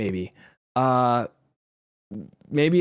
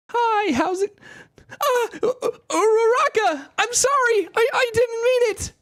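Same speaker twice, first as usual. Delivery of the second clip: put-on high flustered anime boy; panicked falsetto stammer; Deku voice. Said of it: "Deku, I guess, kind of speaks like this".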